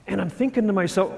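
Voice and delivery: normal talking voice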